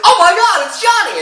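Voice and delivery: wife seeing Johnny back, high and loud; overjoyed wife. Acted. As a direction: shriek it out in mock delight. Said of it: "she is like".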